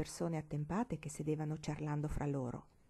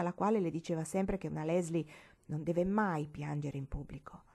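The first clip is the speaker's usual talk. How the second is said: ordinary delivery, nothing marked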